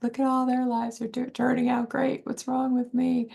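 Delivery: a mild mock lament; envious self-pity. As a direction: mimic your past self, feeling left behind. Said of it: "I'm like".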